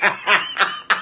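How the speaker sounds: soft, breathy run of laughter; wheezing chuckle that tails away; laughing. Real, not acted. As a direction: laugh helplessly, nearly without voice. Laughs at her own block joke.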